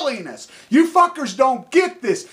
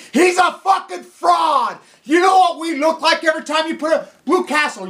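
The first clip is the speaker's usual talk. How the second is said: angry shouting; 5 semitones higher than usual. Real, not acted. Attacking Gunderson as a fraud, shouting angrily. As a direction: yell the accusation.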